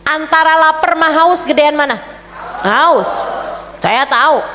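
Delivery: ordinary talk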